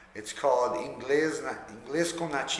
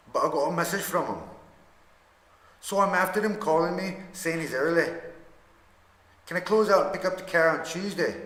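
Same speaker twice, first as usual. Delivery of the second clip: practiced, imperfect Irish brogue; amateur Irish accent; 3 semitones higher than usual. Shown as a demonstration. Learner trying out a customer's chat at the garage in an Irish accent. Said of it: "my terrible Irish accent".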